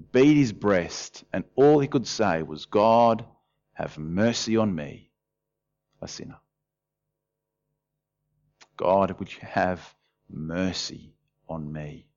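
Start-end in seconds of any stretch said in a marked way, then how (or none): 2.7-6.4 soft, slow penitent prayer; humble plea. Retold or imitated. Preacher voices tax collector begging God, soft and slow. 8.8-12.1 soft, slow, breathy prayer; hushed plea. Retold or imitated. Preacher repeats tax collector's prayer as a hushed plea.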